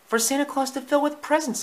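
very high mother-dog voice; eager Lady. Acted. Lady explains stockings to Tramp, very high and eager.

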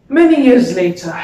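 loud, high theatrical launch into the story; dramatic announcement. Acted. A monologue performer opening the tale of revenge, loud and dramatic.

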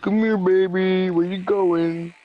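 high, slow luring voice; creepy sweet-talk. Retold or imitated. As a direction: call out sweetly like a stalker. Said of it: "He's like".

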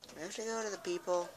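ordinary talk

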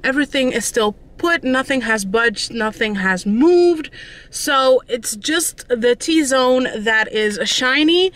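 usual speaking voice

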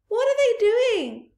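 put-on high, loud scared child; frightened cry. Small foxes crying to their father in fear, high loud cry. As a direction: call out in panic. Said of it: "cried the small foxes".

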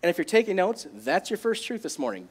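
usual speaking voice

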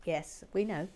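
ordinary talk

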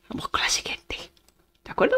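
soft, voiceless whisper; whispered aside. Real, not acted. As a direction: whisper it to yourself. Aside while choosing next level.

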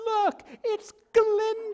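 very high, loud theatrical shout; cast member's cry. Retold or imitated. Cast member heralding Galinda in her bubble, high theatrical cry. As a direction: sing it out to the back row, stretching the name. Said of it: "before the cast member even says".

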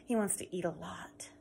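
hushed aside; storyteller whispering about the hungry troll. Acted. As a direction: whisper it to the listeners. Aside to children.

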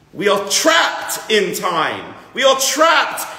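booming, slow and forceful; preacher's rising shout; emphatic shout. Real, not acted. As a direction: hammer home that we are trapped. Stressing humanity is trapped.

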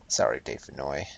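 ordinary talk

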